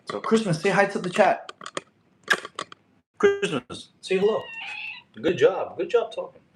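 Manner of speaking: high, playful pet-owner voice; high sing-song praise for a pet; talking to a pet. Real, not acted. Coaxing a pet cat to talk.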